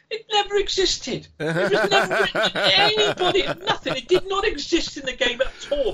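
very high, laughing exclamation; gleeful high-pitched incredulity; amused disbelief. Real, not acted. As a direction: pitch it way up, relishing the punchline through laughter. Laughing at own punchline, listener laughing.

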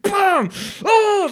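high, loud imitated yell; mock angry outburst. Husband imitating his wife raging at a game, high loud mock yell. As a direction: copy the wife's furious shout at a game. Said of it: "she slaps her legs when she's really angry".

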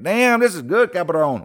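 high, hammed-up Mexican accent; mock Mexican accent. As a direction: play the stepmother with a jokey Mexican accent. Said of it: "She even changed from Italian to Mexican".

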